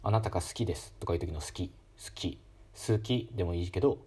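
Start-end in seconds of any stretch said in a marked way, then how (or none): 1.7-2.4 好き with the u silent, whispered su; 好き said as sk'i, no voiced u; devoiced suki. Shown as a demonstration. Teacher demonstrating a devoiced 好き for learners, with a whispered su. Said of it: "無声化が起こって好き".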